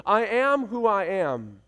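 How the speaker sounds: ordinary talk, nothing marked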